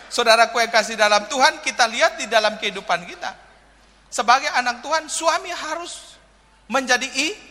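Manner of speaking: fervent sermon delivery; preaching. Acted. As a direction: proclaim it to the congregation. Preaches at full force.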